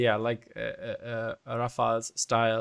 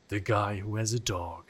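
trailer voice; 2 semitones lower than usual. Shown as a demonstration. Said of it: "let me do the trailer voice".

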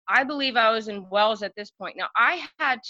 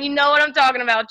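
normal talking voice

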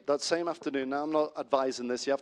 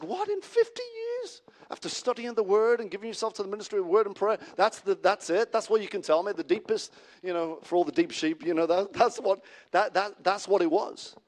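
higher-pitched voice of disbelief; incredulous; 6 semitones higher than usual. Acted. Young minister to veteran minister about his answer, incredulous. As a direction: question it in rising disbelief.

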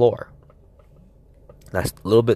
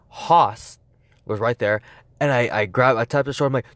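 high, breathless, racing retelling; frantic excited retelling; 7 semitones higher than usual. Retold or imitated. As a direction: tell it like you're still running. Frantic escape retold breathlessly.

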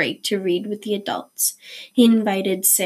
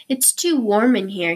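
ordinary delivery

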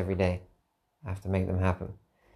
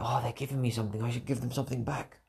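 guilty inner voice; 3 semitones higher, 7 dB quieter, faster than usual. Retold or imitated. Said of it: "guilt mechanism that we have, like".

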